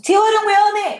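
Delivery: loud, high mock scolding; accusing. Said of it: "라는 소비자 탓했다".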